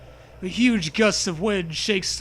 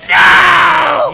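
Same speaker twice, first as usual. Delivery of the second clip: much higher, very loud scream; horrified shout; 11 semitones higher, 17 dB louder than usual. Pretend. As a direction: shriek in mock horror. Shock at sand hands.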